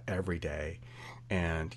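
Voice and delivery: ordinary talk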